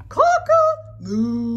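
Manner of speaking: high, sing-song crow gone wrong; mock rooster crow. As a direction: crow it proudly, but wrong. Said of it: "took a deep breath and shouted".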